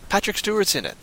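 normal talking voice

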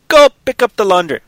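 high, loud play-acted command; mock-imperious order; bossy master. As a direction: order your servant about. Master orders the zombie servant.

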